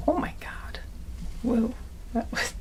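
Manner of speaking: hushed, shaky-free whispered oh my God; startled whisper. Real, not acted. Flustered by the mishap, she whispers to viewers. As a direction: breathe it out in a whisper.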